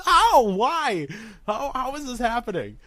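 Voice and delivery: very high, mock-despairing squeal; incredulous wail. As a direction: wail it in comic disbelief. Retelling shock at the callback.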